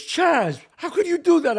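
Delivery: can't believe he did that; exasperated director. Retold or imitated. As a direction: director scolding Chaz for the wrong suit. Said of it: "he looked at me and goes".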